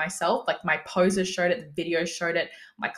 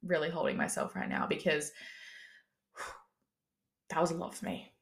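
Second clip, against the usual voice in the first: soft, fast, near-tearful; really holding it together, voice thinned; holding back tears; 2 semitones lower, 9 dB quieter than usual. Real, not acted. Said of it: "almost makes me a little bit emotional".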